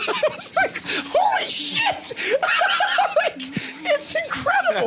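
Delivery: high, squealing laughter through the words; laughing hard. Real, not acted. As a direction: crack up while saying it. Laughing at Denzel quote.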